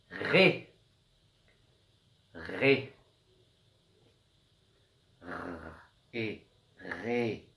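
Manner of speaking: "ré" with a long rolling French r; drawn-out sounds. Shown as a demonstration. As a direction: stretch the r before the é of "ré". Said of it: "il faut bien écouter le son des lettres".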